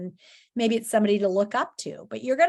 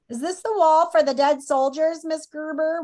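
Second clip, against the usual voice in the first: higher, louder schoolgirl asking; 6 semitones higher, 4 dB louder than usual. Acted. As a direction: call out the question to the teacher. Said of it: "one of them asks in a loud voice".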